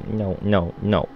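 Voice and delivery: normal talking voice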